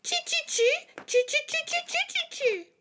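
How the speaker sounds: tiny, very high-pitched monkey squeaks; monkey chatter. A narrator's reading. Monkeys mimicking the scolding peddler, squeaky chatter. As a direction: chatter back like cheeky little monkeys. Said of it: "stamped their feet back at him and said".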